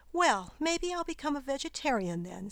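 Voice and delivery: put-on a touch of a girl snapping back; girl snapping at the spider, mildly cross; mild snap. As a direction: retort with light irritation. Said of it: "snapped the girl".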